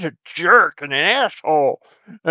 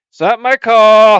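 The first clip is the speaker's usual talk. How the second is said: louder, higher protest; frustrated outburst; 4 semitones higher, 8 dB louder than usual. Acted. Host loudly protesting he has no say over the name. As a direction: vent the grievance loudly. Complaining about show name.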